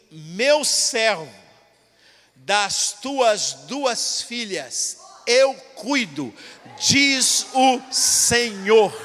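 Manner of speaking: very slow, building to loud proclamation; solemn prophecy. Retold or imitated. Blind prophet to the preacher on the bus steps, slow loud proclamation. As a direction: pronounce it like an oracle, stretched out.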